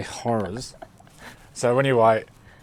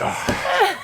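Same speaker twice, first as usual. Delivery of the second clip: very high, loud squeaked oh; relieved oh; 8 dB louder than usual. Retold or imitated. Award winner hearing it was only a joke, high relieved squeak.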